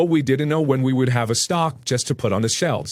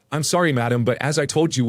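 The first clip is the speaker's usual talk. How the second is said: usual speaking voice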